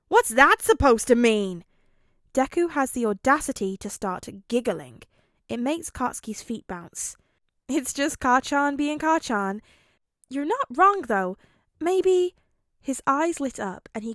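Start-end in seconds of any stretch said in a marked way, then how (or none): 0.1-1.6 loud offended snap; angry retort. A narrator's reading. Katsuki offended. 7.7-11.4 put-on amused boy voice. A narrator's reading. Deku amused. 11.8-12.3 high loud excited maybe; light up with the idea. A narrator's reading. Deku has idea.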